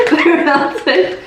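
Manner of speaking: high laugh breaking into words; giggling at the melted candy. Real, not acted.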